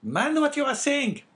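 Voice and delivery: very high, loud woman's character voice; scolding wife. Acted. Wife interrupting her husband from the stairs, scolding.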